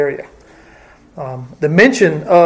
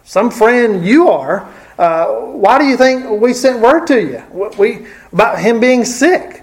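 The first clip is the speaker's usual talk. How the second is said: hurt accusing friend, pitch raised; mock accusation, sharply higher; accusatory Martha; 6 semitones higher, 3 dB louder than usual. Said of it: "Could this have been an accusation?".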